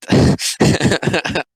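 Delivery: high, breathy burst of laughter; real laugh. Real, not acted. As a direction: crack up at the comic. Laughs at the comic.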